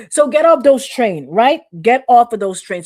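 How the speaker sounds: loud, slow preacher's exhortation; preaching at full force. Real, not acted. Preacher urging the church to leave the wrong train, loud and slow. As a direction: bellow it to the congregation.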